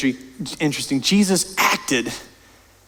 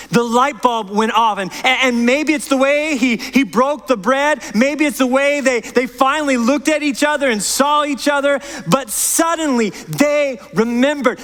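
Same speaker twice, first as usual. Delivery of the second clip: raised, driving sermon voice, pressing each point; impassioned preaching; 8 semitones higher than usual. Acted. Pastor urging the church to follow Jesus, impassioned preaching. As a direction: preach it out to the whole congregation.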